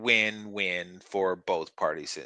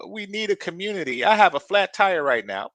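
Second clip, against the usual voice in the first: high, whiny mock of a needy woman; a lightly high mock of the woman in her car; 9 semitones higher, 5 dB louder than usual. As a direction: parody her pleading for help. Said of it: "the one chick in her car was talking about".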